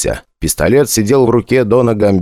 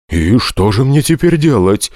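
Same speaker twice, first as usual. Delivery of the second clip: trembling voice; 4 semitones higher than usual. A narrator's reading. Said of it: "уже дрожащим голосом поинтересовался Оралиус".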